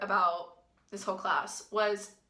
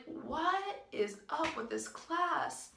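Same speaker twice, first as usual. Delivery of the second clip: incredulous aside; 7 semitones higher, 4 dB quieter, much slower than usual. Retold or imitated. Her inner reaction to the crying.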